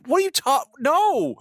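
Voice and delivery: high, cut-off disbelief; incredulous high. Retold or imitated. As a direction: squeak out the protest. Retold outrage at wait.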